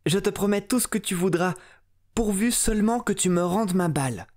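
high, fast eager promise; hasty princess. Acted. Princess hastily promising the frog, high and fast. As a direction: rush the promise out. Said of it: "lui répondit la fille du roi".

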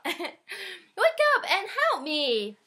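high, slow shouted plea. A narrator's reading. Friends yelling at sleeping Jesus, slow high shout. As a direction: beg him to wake, full shout. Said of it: "shouted Jesus' friends".

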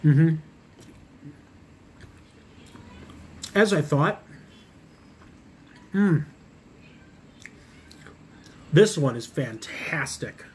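chewing while talking; muffled through food; mouth full. Real, not acted. Seen 2: bites slider at -3, chews with closed full mouth throughout.

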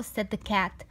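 usual speaking voice

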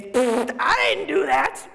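scornful little denial; dismissive scoff. Retold or imitated. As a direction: brush it off with a pfft. Dismissive reaction to criticism.

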